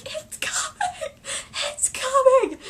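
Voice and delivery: very high, slow excited squeal; retold fan squeal. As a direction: stretch it out in thrilled disbelief. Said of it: "I was so excited".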